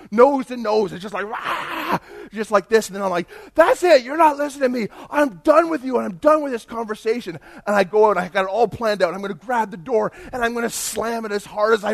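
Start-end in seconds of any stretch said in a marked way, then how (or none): 1.3-2.0 high, drawn-out mock roar; short hammed-up yell; mock scream. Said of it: "he starts screaming at me". 3.5-7.4 high, loud acted outburst; hammed-up angry shouting; retold screaming. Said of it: "I start screaming at him".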